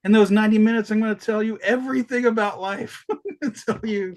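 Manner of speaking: mock pompous auteur; lightly voiced director making a grand boast. Voicing Bergman's grand claim.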